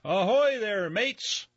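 high, loud sailor's ahoy; swaggering sailor hailing loud; hearty hail. Acted. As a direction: hail the town from the wagon.

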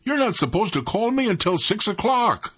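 put-on high-pitched boyish protest, little extra volume; mock yell. A narrator's reading. Son woken too early objects to mother, high mock yell. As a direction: complain high, as if yelling from bed. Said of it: "I yelled".

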